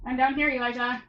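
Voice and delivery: loud call to another room. Real, not acted. Mother calling out to her son Elijah, loud real shout.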